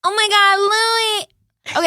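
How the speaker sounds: high, very slow Jersey drawl; Teresa Giudice. Pretend. Practicing Teresa calling to Louie, high slow drawl. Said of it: "Jersey Elmo".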